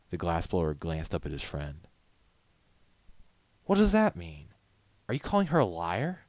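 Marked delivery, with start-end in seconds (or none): none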